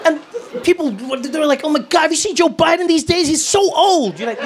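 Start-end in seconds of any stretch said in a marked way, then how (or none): 1.7-4.2 high-pitched, appalled gossip; mock shock. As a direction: imitate scandalised people gossiping. Mimics appalled critics of Biden.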